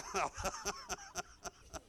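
long, breathy high chuckling; real laughter. Real, not acted. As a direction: laugh helplessly at your own story. Laughs at his own story.

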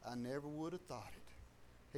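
low, slow, hushed disbelief; retold quiet amazement. Driver looks at the dry job site, quiet retold amazement. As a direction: drop down soft and slow, imitating a stunned doubter.

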